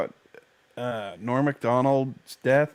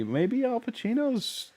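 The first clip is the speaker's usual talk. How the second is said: mock high voice; 12 semitones higher, 6 dB quieter than usual. Mocking Twitter reaction.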